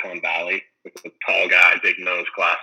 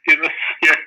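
higher, louder imitation of a stickup; mock robbery demand; 7 semitones higher, 4 dB louder than usual. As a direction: play-act demanding the loot, raised voice. Acted robbers demand money at gunpoint.

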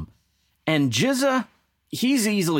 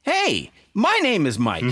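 playful exclaim; 11 semitones higher than usual. Real, not acted. Jokes on track title Iron Mike.

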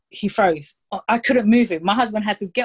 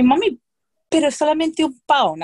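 put-on higher and louder, wheedling little-boy voice; begging child; 7 semitones higher, 5 dB louder than usual. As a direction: plead like a small son asking for just one pound. Son bargaining for the toy.

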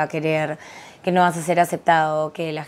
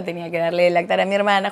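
ordinary delivery

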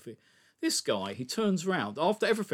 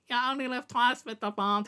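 higher, mocking villain voice; mocking a Bond villain's menacing line in a higher voice; mocking Blofeld impression; 7 semitones higher than usual. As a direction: send up a villain's sinister line, pitched up. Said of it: "He talks like this all the time".

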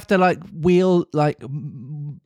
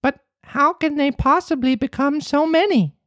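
high, wide-eyed puppet voice; high wondering boy; wondering Pinocchio. Acted. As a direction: ask in childlike wonder. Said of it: "asked Pinocchio wonderingly".

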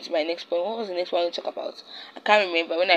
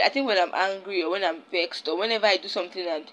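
normal talking voice

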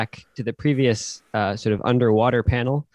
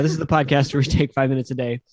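normal talking voice

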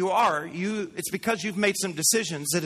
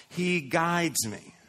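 slow emphasis; much slower than usual. Real, not acted. Preacher slows on key phrase.

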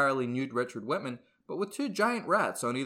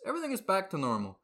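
normal talking voice

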